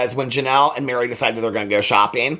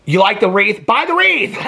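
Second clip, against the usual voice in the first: higher and louder, fed-up snap; exasperated; 7 semitones higher, 5 dB louder than usual. Retold or imitated. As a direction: end the debate: just buy it. Mary in confessional, fed up.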